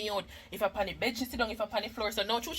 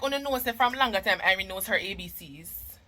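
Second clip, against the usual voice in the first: ordinary delivery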